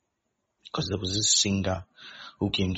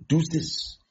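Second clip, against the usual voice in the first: higher, louder mock command; mom's bossy order; 7 semitones higher, 4 dB louder than usual. Mom ordering her child around, higher and louder. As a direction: bark it like a mom giving orders. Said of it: "your mom is telling you".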